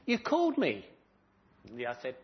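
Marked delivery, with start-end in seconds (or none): 0.1-0.9 eager question. Acted. Samuel runs to Eli again.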